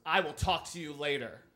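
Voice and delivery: loud imitated teacher's warning; loud, stern dismissal; stern rebuke. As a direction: cut off an objector forcefully. Teacher snaps back at classmate.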